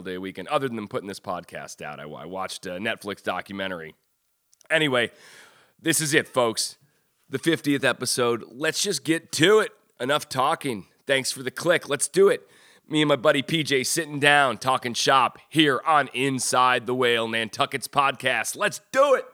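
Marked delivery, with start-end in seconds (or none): 4.7-11.9 hype show intro. Acted. Hypes the episode intro. 11.9-12.5 excited hype. Acted. Hyping the show start. 12.9-18.6 hype show intro. Acted. Hypes the episode intro. 18.6-19.3 much higher, excited hype; much higher hype shout. Acted. Hyping the show start, bigger.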